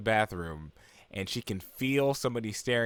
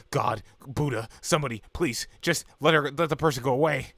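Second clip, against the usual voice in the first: mock desperate prayer, raised voice; louder, higher imitation of a plea; pleading; 4 semitones higher, 5 dB louder than usual. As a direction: beg for rescue, hammed-up.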